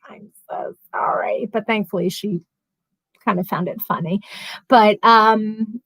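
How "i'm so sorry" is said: small mock-embarrassed whisper; sheepish whispered apology. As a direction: whisper it, mortified, as a retold apology. Retells sheepish apology to her friend.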